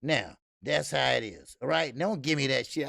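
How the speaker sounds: ordinary delivery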